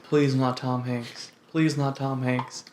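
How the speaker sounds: soft, slow pleading; quiet plea. Retold or imitated. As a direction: beg quietly under your breath. Pleading about Me Too news.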